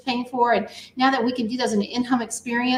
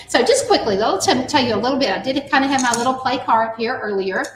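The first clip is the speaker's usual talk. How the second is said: normal talking voice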